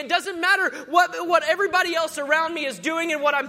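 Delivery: loud, rising declaration from the pulpit; full-force preaching. Acted. Preacher to congregation, full-force preaching. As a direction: declare it over the crowd.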